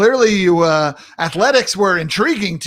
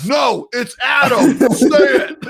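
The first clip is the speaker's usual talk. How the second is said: playful mock shout, higher and drawn out; mock insistence; 7 semitones higher, 5 dB louder, slower than usual. Host imagining guest refusing his nickname, mock shout. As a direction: shout it in pretend protest, slowly.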